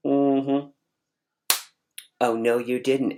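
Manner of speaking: lightly sassy mm-hmm; a touch of smug "oh no you didn't"; cocky attitude. Retold or imitated. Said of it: "that real cocky a-, attitude".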